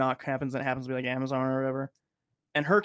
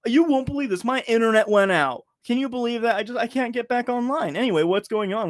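chirpy surprise; 8 semitones higher, 5 dB louder than usual. Retold or imitated. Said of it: "She's like".

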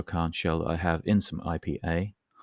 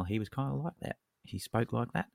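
mock Australian lilt, voice going up; higher, softer Aussie impression; Australian accent; 3 semitones higher, 7 dB quieter than usual. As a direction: imitate an Australian with rising inflection. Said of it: "and his voice went".

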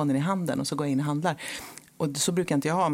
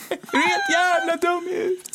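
high, loud mock yell of fury; imitating an angry yell; 3 dB louder than usual. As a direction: scream it like he's lost it. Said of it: "Han var ju så lack".

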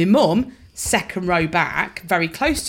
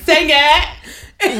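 high, louder, laughing; laughing mock heckle; 7 semitones higher, 6 dB louder than usual. [name] replaying Mum's heckle, laughing, higher and louder. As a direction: call it out, cracking up. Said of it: "And Mum went".

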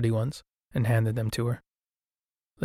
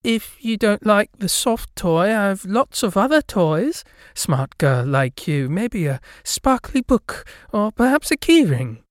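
high, slow saleswoman sell; pushy shop lady; 8 semitones higher, 4 dB louder, slower than usual. A narrator's reading. Gift shop lady pushing toys on a girl, high and slow. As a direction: coax the girl into buying more.

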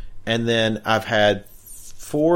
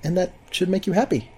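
upbeat ad read; 7 semitones higher, 5 dB louder than usual. Acted. Ad read with coupon code.

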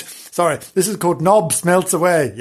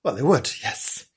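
ordinary delivery, nothing marked

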